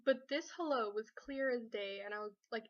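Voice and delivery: ordinary talk, nothing marked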